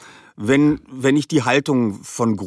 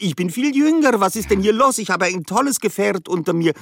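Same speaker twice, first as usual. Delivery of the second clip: put-on much higher, excited teenage Gru; younger Gru voice; 7 semitones higher than usual. Shown as a demonstration. Voice actor shows how young Gru sounds, higher and excited. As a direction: lift it up like a wide-eyed thirteen-year-old. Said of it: "da klingt Stimme schon wieder ganz anders".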